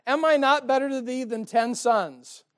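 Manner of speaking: husband boasts to his crying wife, hammed-up; boastful husband. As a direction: puff up like a proud husband. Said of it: "'Cause he says to her".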